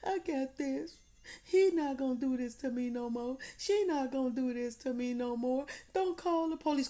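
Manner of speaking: high put-on voice of a victim who brushes off help; pleading victim. Voicing victim who refuses help.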